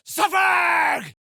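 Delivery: loud, high yell of a name; sharp, high call for attention. Acted. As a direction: shout the name across the field. Calling out a comrade's name.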